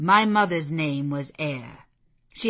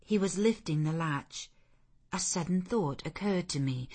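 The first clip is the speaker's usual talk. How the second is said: ordinary delivery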